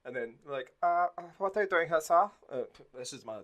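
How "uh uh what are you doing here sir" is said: lightly voiced Nazi officer, higher and louder; a hint of a stiff German officer; officer on the train platform, lightly voiced Nazi; generic Nazi voice. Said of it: "this is my generic Nazi voice now".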